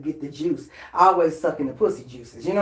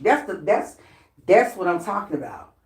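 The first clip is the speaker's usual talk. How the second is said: ordinary delivery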